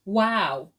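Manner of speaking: normal talking voice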